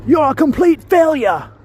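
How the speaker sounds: high, loud British chef tirade; mock-furious celebrity chef berating a cook; Gordon Ramsay yelling. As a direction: yell it with an exaggerated British accent. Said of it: "he yells a lot".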